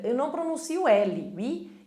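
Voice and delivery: ordinary delivery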